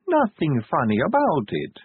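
slow, sugary and pointed; sweetly menacing. A narrator's reading. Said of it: "said Sally sweetly".

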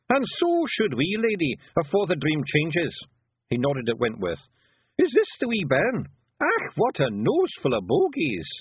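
lively little Scottish warrior; Feegle leader. Acted. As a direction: play a brisk wee Scots chief.

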